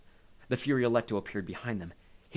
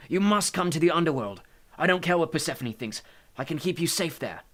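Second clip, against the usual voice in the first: raised, higher, urgent pleading; agitated urging; 7 semitones higher, 6 dB louder than usual. A narrator's reading. Hades urges the woman to safety, agitated. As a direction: urge her anxiously to safety. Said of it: "like he was agitated".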